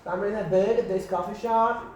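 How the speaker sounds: high American anecdote voice; chatty American storyteller, high and lively; dramatic American accent. Shown as a demonstration. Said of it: "you can be more dramatic".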